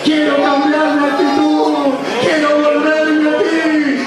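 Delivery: slow, rising pleading prayer; fervent prayer. Acted. Leads a prayer.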